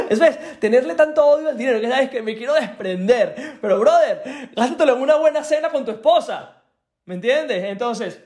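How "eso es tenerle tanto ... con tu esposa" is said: talking through laughter; amused, chuckling delivery; laughing. Real, not acted. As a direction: laugh while giving the advice. Mocking client's ad spending.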